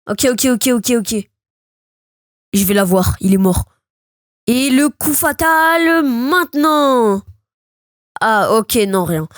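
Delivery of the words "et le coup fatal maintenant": stretched-out fight-finisher announcement; triumphant finishing call. Real, not acted. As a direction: announce the knockout blow very slowly. Calls the final blow.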